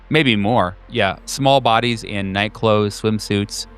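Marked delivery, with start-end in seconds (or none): none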